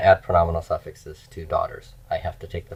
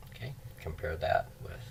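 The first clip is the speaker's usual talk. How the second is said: whisper; 17 dB quieter than usual. Real, not acted. Aside while writing.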